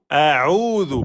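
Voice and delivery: slow chanted "a'udhu" with a drawn-out u; long vowel. Shown as a demonstration. Teacher pointing out the prolongation, "a'udhu" held long. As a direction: stretch the middle of "a'udhu". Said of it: "prolongation de temps".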